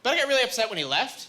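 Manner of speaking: ordinary delivery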